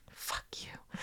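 unvoiced under-the-breath curse; whispered curse. Parent silently curses the pricey bake sale, whispered. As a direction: hiss it so nobody at the sale hears.

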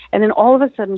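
plain reading voice

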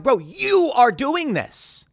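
high, drawn-out emphasis on the accusation; retold incredulous comeback; exasperated retort. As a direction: push back slowly, pitch jumping up in disbelief. Retelling his exasperated retort.

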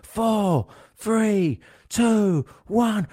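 high excited countdown; countdown shout. Retold or imitated. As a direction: chant the countdown, high and eager. Midnight countdown.

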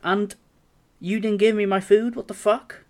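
mock outrage, demanding the food back; angry complaint. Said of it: "I go to the counter and say".

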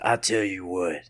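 mock tough-guy aside; Steven Seagal impression. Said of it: "If you do Steven Seagal's voice".

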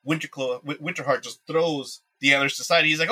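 ordinary talk

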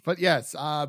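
usual speaking voice

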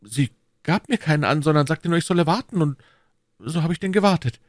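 high-pitched voice for the meek shopkeeper; Bonacieux. A narrator's reading. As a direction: tell it high, as the humble husband. (hoher, unterwürfiger Krämer)

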